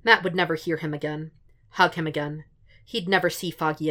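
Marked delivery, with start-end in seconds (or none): none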